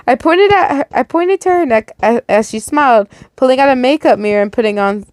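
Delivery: ordinary delivery, nothing marked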